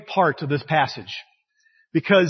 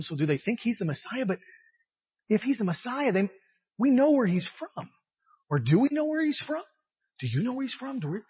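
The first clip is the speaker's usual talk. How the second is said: much softer puzzled questioning; inward complaining; 3 semitones higher, 7 dB quieter than usual. Acted. People privately wondering if he's the Messiah, inward complaining. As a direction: voice private doubts under your breath, pitch climbing on questions. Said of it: "complaining in their head".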